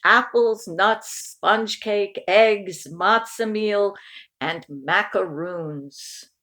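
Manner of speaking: plain reading voice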